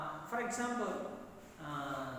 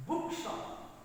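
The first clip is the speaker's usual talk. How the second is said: BOOK in Bookshop hit higher, shop dropping away; stress on first syllable; 4 semitones higher than usual. Shown as a demonstration. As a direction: demonstrate Bookshop with extra force on Book. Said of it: "where is an extra force is used".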